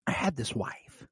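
quiet, drawn-out menace; Joker. Acted. Fan quoting the villain holding a hostage, hushed Joker impression. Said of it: "he's like".

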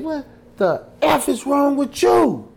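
high, loud confrontation; acted outraged challenge; angry retort, re-enacted. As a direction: fire back at him, high and loud. Associate's angry retort, retold.

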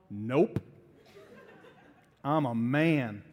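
low-pitched mock tough guy; macho man. Husband refusing help with the grocery bags, mock macho. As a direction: drop low into stubborn manly bravado. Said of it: "kinda like the macho man thing".

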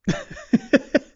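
shrill burst of giggling; high squeal of laughter. Real, not acted. Host squeals with laughter at friend's mistake.

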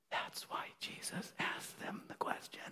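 a sudden hushed whisper. Real, not acted. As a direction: lean in and whisper it.

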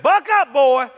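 loud, high buck up; aunt's command. Retold or imitated. Tough aunt at the funeral, loud command. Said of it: "she said".

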